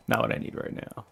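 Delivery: whispered under the breath; exasperated whispered aside. Real, not acted. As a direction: whisper it to yourself, fed up. Muttering at exhausted horse.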